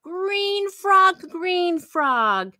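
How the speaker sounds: bright, lilting read-aloud for little ones; sing-song the refrain to the kids; reading to children. Acted. Librarian reading the refrain to children, sing-song.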